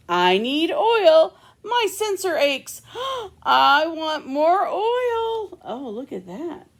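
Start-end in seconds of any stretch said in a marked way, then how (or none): 0.1-5.6 high pleading robot voice; begging for more oil, high and slow; whiny robot. Acted. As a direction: whine like a thirsty little robot. Robot child whining for oil.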